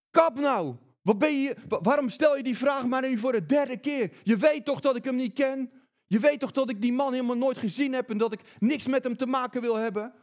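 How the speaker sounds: high, loud, furious denial; cursing outburst. Retold or imitated. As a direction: snap back, fed up at the third question. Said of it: "Dan begint hij te vloeken".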